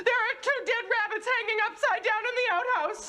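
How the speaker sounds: high shriek, then crying out; horrified scream. Acted. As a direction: scream in horror. Finds dead rabbits in outhouse.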